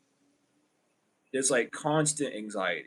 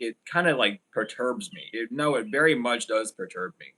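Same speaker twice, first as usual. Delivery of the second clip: ordinary talk, nothing marked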